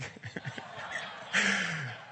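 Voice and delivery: real laugh after the joke; laughing at the punchline. Real, not acted. Preacher laughing at his own joke.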